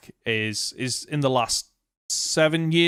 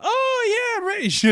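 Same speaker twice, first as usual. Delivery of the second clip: high, breezy imitation of family; high mimicking voice. Family's offhand reply imitated.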